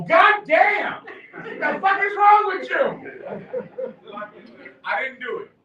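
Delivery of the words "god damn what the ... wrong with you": high, loud, laughing "what the fuck"; mock-furious yell through laughter; imagined outburst. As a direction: blurt it out half laughing. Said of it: "I wanted to go".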